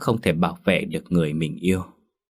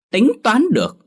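"Tính toán được?" thrown back much higher and louder; wife mimicking her husband scornfully; mocking echo; 5 dB louder than usual. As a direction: sneer his own words back at him. Wife mocking his words.

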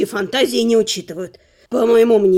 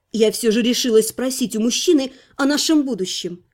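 ordinary delivery, nothing marked

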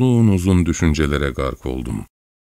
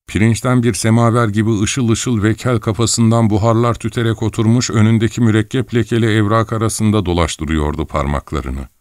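usual speaking voice

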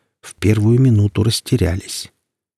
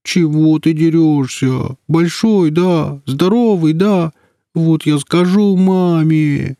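put-on much higher, slow whimpering child voice; whiny little kid; 7 semitones higher, slower than usual. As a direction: whine like a small boy about to cry. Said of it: "вдруг захныкал, совсем как маленький".

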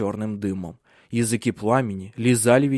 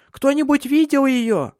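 put-on very high, loud girl's voice; frantic child asking everyone for help; child crying out; 6 dB louder than usual. A narrator's reading. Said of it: "закричала Суль". (громкий высокий детский крик)

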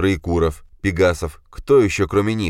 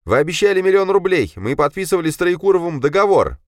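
nervous pleading; 14 semitones higher, 6 dB louder than usual. A narrator's reading. Dima pleads with captors.

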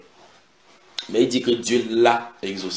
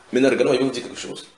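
ordinary talk

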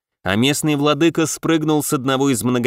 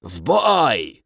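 rasping battle croak; hoarse caw; 3 semitones lower than usual. Acted. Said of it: "хрипло каркнул командир ледышек".

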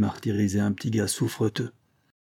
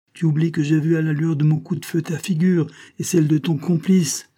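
accusing, building on each "Tu oublies"; insistent, rising to loud and high by the last "Tu oublies"; each phrase hammered; 6 semitones higher, 5 dB louder than usual. A narrator's reading. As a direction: punch every "Tu oublies" as an accusation. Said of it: "accentuant chacune de ses phrases d'un coup sur l'épaule".

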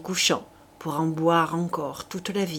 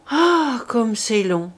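grumbling; 4 semitones higher, 5 dB louder, slower than usual. A narrator's reading. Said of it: "grogna la poule".

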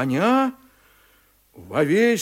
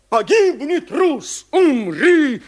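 high, loud battle cry; furious shout; 10 semitones higher, 8 dB louder than usual. A narrator's reading. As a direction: roar the death threat. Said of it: "вещает".

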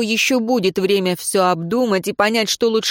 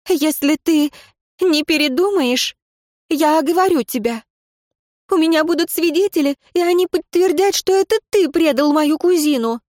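high, fast, shaky threat; trembling threat; 9 semitones higher than usual. A narrator's reading. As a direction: threaten though the voice keeps shaking. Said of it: "Голос так и продолжал подрагивать от волнения".

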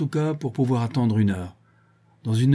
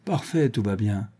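ordinary delivery, nothing marked

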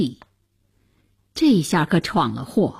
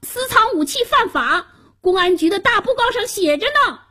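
put-on very high child's voice, hurrying at the end; protesting boy; 12 semitones higher, faster than usual. A narrator's reading. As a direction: recite the law like a nervous schoolboy. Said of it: "嘴里却喃喃说".

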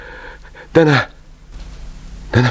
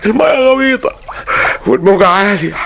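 desperate calling; 9 semitones higher, slower than usual. Acted. Drowning man calling out to Azza to watch out, desperate shout. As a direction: call out to her across the waves.

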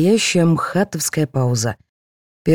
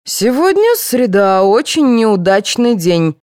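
slow, raised, singsong lecture; droning pronouncement; 6 semitones higher, 4 dB louder, slower than usual. A narrator's reading. Vladimir starting his horoscope talk, intoning slowly. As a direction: intone it as an ominous forecast. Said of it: "завёл Владимир".

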